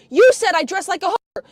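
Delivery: ordinary talk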